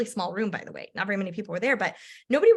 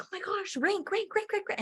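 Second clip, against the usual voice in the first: much higher, breathless rank, rank, rank; giddy disbelief at the ranks; excited chatter; 11 semitones higher, 5 dB quieter than usual. Retold or imitated. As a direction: rattle it off giddy. Everyone ranking up rapidly.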